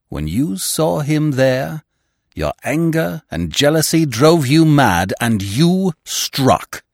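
loud, high, slow-building accusation; ringing, emphatic charge; dramatic accusation. A narrator's reading. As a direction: drive it home forcefully, drawing out the final words. Narrator acts out accusing her.

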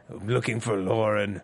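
low, softened character imitation; Dyson. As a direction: drop low to mimic the brooding TV character. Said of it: "it's a Dyson impression".

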